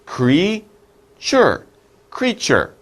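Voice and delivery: Creature split into two sounds, KREE then chur; syllable demo. Shown as a demonstration. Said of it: "It's two syllables, two sounds".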